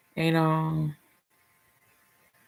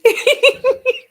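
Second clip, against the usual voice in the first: high loud laugh; 8 dB louder than usual. Real, not acted. Laughs at own joke.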